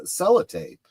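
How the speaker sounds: usual speaking voice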